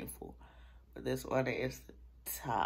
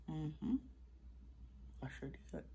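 usual speaking voice

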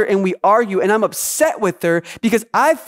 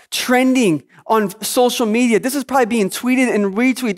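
ordinary talk

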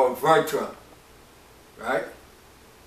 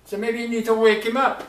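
mocking taunt; 6 semitones higher than usual. Prophet mocking Baal's worshippers, jeering. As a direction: taunt them to cry some more. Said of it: "Elijah mocked them".

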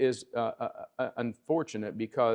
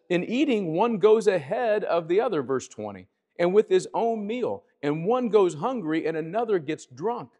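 quoting Paul raised high and louder, fervent; preaching scripture; 6 semitones higher, 3 dB louder than usual. Acted. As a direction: lift the voice and proclaim the verse.

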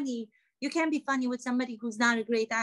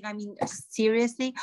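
ordinary talk, nothing marked

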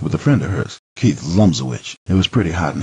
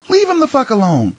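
much higher, louder shout; furious yell; 4 dB louder than usual. Acted. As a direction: scream at him to back off. Wife shouting at husband.

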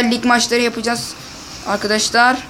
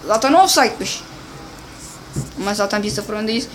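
normal talking voice

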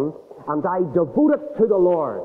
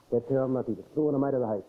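usual speaking voice

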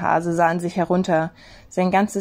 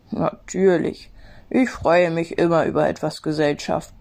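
smiling gentle snowman; 2 semitones lower than usual. A narrator's reading. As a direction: say it with a smile. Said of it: "lächelte der Schneemann".

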